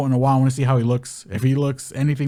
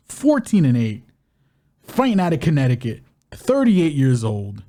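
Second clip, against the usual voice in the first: fight announcer. Acted. Announcer-style fighter intro.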